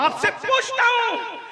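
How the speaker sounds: high, slow, loud demand; shouted challenge. Real, not acted. Preacher confronting the congregation, loud high challenge. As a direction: call it out and stretch it.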